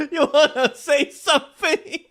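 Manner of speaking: high, laughing tease. Real, not acted. Streamer teasing Anne over a clip, laughing. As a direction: squeeze the words out through laughter.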